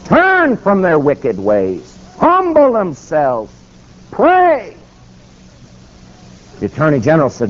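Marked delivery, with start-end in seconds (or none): 0.1-4.8 slow, high declaimed commands; preacher's exhortation. Real, not acted. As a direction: cry out each command, stretched long. Preacher declaims scripture to congregation.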